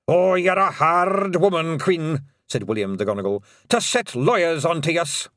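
high, loud reproachful Scots; aggrieved Scots elder; Scots pixie chief. Acted. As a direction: scold the queen. William rebukes Queen.

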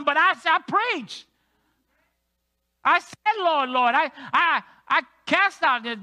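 loud desperate plea; pleading cry at the gate. Pretend. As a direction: beg to be let in, loud. Pastor acting at heaven's gate.

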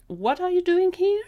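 high, loud and bewildered; startled question. Acted. Gary questions the artist he just met, startled. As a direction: blurt it out, pitch leaping.

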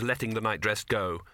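plain reading voice

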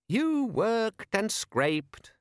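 high, very slow, tired sing-song; weary recital. Acted. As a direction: drag it out, bored of the old story. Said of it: "said Les wearily".

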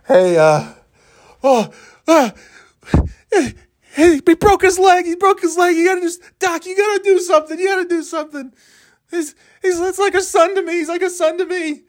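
very high falsetto pleading with the vet; frantic pet owner. Pretend. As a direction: beg the doc in squeaky mock panic. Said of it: "he probably goes to the vet".

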